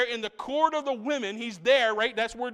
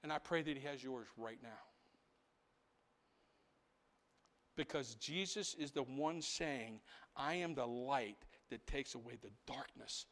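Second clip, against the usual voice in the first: much softer, lower, intimate appeal; prayerful appeal; 8 semitones lower, 15 dB quieter than usual. Acted. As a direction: draw them in tenderly.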